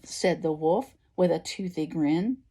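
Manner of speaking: ordinary delivery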